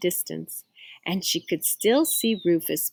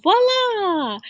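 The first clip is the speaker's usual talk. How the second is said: high, drawn-out flourish; triumphant exclamation; 11 semitones higher, 3 dB louder than usual. Acted. Carmen finishing her painting, triumphant. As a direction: announce it with a proud flourish.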